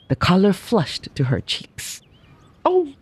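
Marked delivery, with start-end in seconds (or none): 2.6-2.9 cry of joy. Acted. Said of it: "she cried".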